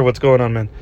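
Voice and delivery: ordinary delivery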